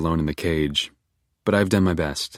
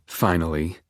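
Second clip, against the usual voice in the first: usual speaking voice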